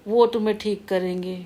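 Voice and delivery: usual speaking voice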